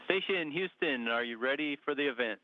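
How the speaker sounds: sci fi communicator. Real, not acted. Space station radio link check.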